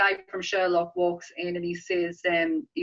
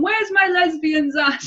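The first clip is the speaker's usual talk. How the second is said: put-on old lady calling out; 10 semitones higher, 7 dB louder, slower than usual. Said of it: "an old lady at the end of the film going".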